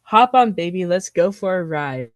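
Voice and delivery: normal talking voice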